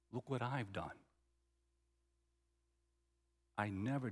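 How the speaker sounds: very low, very soft aggrieved confession; hushed hurt. Retold or imitated. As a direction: act out the older brother's grievance almost under the breath. Brother voices wounded resentment.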